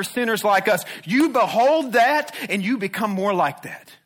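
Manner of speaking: loud, driving sermon cadence; fervent pulpit proclamation; preaching at full force. Acted. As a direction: hammer each truth home to the church. Preacher builds on the gospel.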